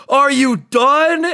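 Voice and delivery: high, loud, drawn-out exasperated shout; full-force teacher's bellow, acted out; imitated yell. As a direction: yell it slowly like a fed-up teacher. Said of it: "and just yells".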